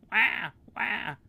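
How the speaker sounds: high loud wailing wah; mock baby cry. Newborn baby crying, high mock wail.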